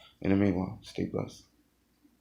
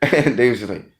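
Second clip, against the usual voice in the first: ordinary talk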